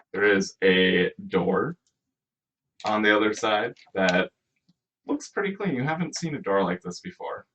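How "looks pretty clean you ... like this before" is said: ordinary talk, nothing marked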